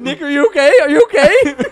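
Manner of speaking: high, loud laughing check-in; checking on Nick while cracking up; laughing concern. Real, not acted. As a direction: ask through laughter. Laughing at friend holding breath.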